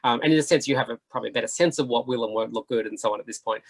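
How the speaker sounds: ordinary talk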